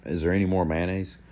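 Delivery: deadpan, quiet and level, no smile in it; bone-dry joke, sold with a straight face. Retold or imitated. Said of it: "I said it kinda just like that, just real, real dry".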